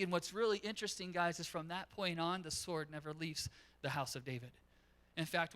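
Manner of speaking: usual speaking voice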